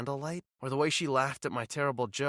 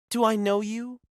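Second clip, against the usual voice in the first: condescending; 9 semitones higher, 5 dB louder than usual. Acted. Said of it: "Her voice was dripping with condescension".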